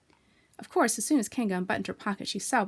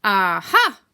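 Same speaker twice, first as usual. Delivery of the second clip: louder, strained little aha; Piglet's aha; 11 dB louder than usual. A narrator's reading. Piglet trying to scare Kanga, weak aha. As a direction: try to sound knowing but fail.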